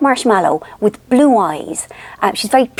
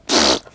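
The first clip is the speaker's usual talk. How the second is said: short low lip buzz; blown raspberry. Retold or imitated. Aunt showing her turn in the game, short raspberry. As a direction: blow a raspberry at the baby. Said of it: "I do like this, I go".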